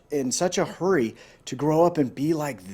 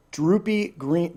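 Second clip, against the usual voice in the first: ordinary delivery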